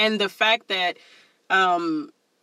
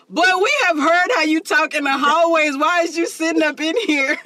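raised, louder incredulous telling-off; mock scolding; 7 semitones higher, 3 dB louder than usual. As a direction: call the kid out on his act, pitched up. Mock scolding of the fibbing kid.

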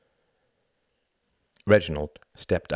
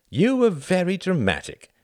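high, raised voice with a wan smile; 12 semitones higher, 5 dB louder than usual. A narrator's reading. Wendy to Tom after the debate, wanly smiling. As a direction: lift the pitch, smile weakly through it. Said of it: "smiling wanly".

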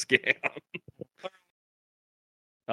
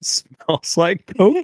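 high, slow teasing question; high mock question; 9 semitones higher, slower than usual. Real, not acted. Mocking guess, slow and high.

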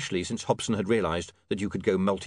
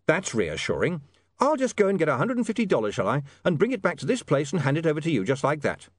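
dry start, then pitched-up mock agreement; sarcastic; 5 semitones higher than usual. William scoffing at handing money to a stranger, sarcastic. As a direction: pretend to go along with it.

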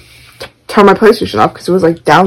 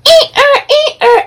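high squeaky rubbing noise, eh ah; balloon squeak imitation. Sister rubbing a balloon, imitated squeaky noise. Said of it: "she's like".

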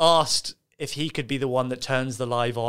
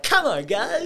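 high hyped-up trainer voice; fitness instructor; 12 semitones higher, much slower than usual. Retold or imitated. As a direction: cheer like an empty-room coach. Imitating lone workout streamer.

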